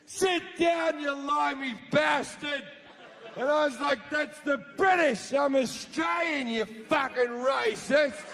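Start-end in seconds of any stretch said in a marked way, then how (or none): 0.1-2.6 mock angry yell from a drunk heckler; hammed-up heckler shout; heckler's yell. Heckler yelling at him. 4.1-8.2 indignant shout, slowing at the end; retold angry comeback; yelling back.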